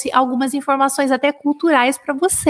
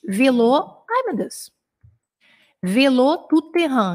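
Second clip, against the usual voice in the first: slow dictation; slower than usual. Shown as a demonstration. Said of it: "Anotem aí".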